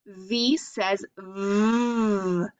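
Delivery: very slow, drawn out V says V; slow V demo. Shown as a demonstration. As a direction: stretch every V sound slowly. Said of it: "See my mouth?".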